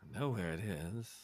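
low, very soft ghostly reply; quiet, low menace; menacing dead man. Acted. Dead Wilhelm to his killer, low quiet menace.

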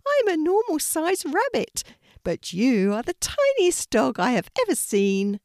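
high, loud little rabbit voice; gentle rabbit calling out to a scared puppy; bright high rabbit, loud. A narrator's reading. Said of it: "said the rabbit, laughing".

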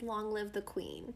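solemn farewell. Retold or imitated. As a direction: let the words fall away quietly. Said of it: "his last line to her is".

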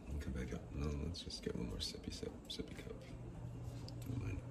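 soft playful whisper. Real, not acted. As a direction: whisper it like a secret. Sneaking another sip of coffee.

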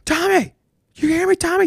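dad coach screaming pitching tips at Tommy, high and loud; imitated shout. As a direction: holler it across the field. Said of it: "he would just yell at him".

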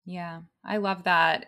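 ordinary talk

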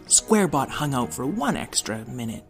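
ordinary delivery, nothing marked

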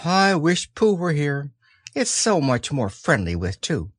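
a lonely little wish; wistful Piglet. A narrator's reading. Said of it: "gave a very long sigh and said".

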